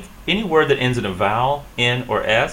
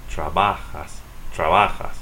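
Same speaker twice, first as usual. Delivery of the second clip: "Trabajas" as tra-BA-has; Spanish "Trabajas", middle syllable stressed; stress next-to-last syllable; 3 semitones lower, slower than usual. Shown as a demonstration. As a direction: put the weight on "ba" in "Trabajas". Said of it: "So stress it here".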